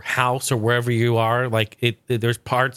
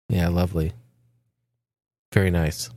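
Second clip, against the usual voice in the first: much lower, put-on guest voice answering the host; Nick Cage impression; 8 semitones lower than usual. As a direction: drop low and answer as the pretend guest.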